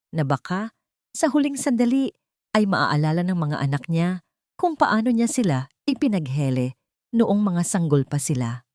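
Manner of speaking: ordinary delivery, nothing marked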